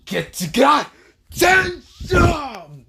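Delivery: high, loud battle yell; attack shout. Acted. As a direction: scream the attack name. Attack name shouted in fight.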